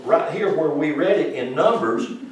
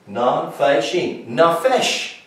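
ordinary delivery

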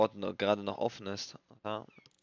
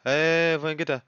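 startled call; 6 semitones higher, 10 dB louder than usual. Real, not acted.

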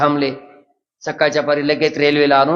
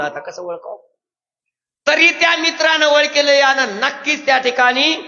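usual speaking voice

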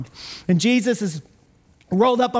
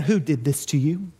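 lower, quieter accusing question; suspicious Pharisee; 7 semitones lower, 3 dB quieter than usual. Retold or imitated. Pharisees grilling the healed blind man, low and accusing. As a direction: interrogate him like a suspicious Pharisee.

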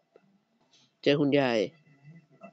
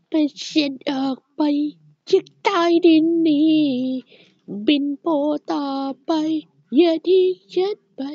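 mock dramatic falsetto; 11 semitones higher than usual. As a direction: plead like a trapped heroine, overdone. Mock dramatic plea to escape. (ร้องเสียงสูงแบบแกล้งดราม่าขอให้ปล่อยออกจากบ้าน)

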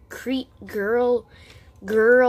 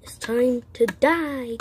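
ordinary talk, nothing marked

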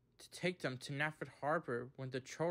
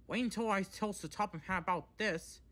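higher-pitched scowling threat; 6 semitones higher, 4 dB louder than usual. A narrator's reading. Edward hears what the trucks told him, scowling. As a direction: warn them crossly, pitch raised. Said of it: "Edward scowled".

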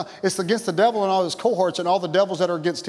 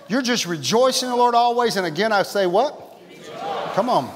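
usual speaking voice

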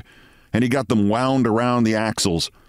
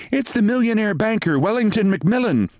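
pretty nurse; 12 semitones higher than usual. A narrator's reading. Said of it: "said the pretty nurse".

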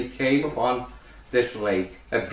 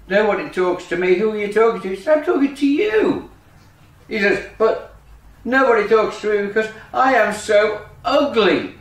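higher duckling voice in story dialogue; woeful ugly duckling; 6 semitones higher than usual. Acted. Duckling tells the frog nobody talks to him, woeful. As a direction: lament in a raised little voice.

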